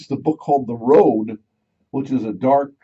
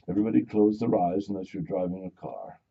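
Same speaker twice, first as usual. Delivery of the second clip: mock guided meditation for drivers and offices; 6 semitones lower, 9 dB quieter than usual. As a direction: talk them down into calm breathing.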